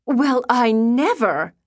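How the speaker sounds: slow, high, offended huff; a scandalized prim lady taking offence; prim, affronted and drawn out; huffy. A narrator's reading. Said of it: "Libba huffed".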